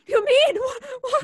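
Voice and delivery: sudden high, loud gasping question; startled cry of fear; alarmed. Acted. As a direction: jolt into alarm. Shock at his news.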